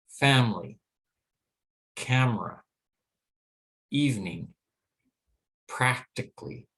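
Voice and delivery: reduced forms, CON-frence, AV-rage, CAM-ra, PRAC-tic-ly; unstressed syllable dropped. Shown as a demonstration. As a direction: stress each first syllable and drop the weak vowel, as in FAM-ly, EV-ning. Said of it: "I'll go ahead now and pronounce these words".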